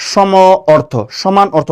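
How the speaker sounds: সম and অর্থ said apart with a pause between; split into parts. Shown as a demonstration. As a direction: break সম / অর্থ apart to show the meaning. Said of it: "উচ্চারণ করেন".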